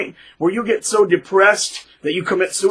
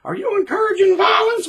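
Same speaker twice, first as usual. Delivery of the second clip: high squeaky voice of a shocked listener; mock objecting listener. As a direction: play the scandalized viewer.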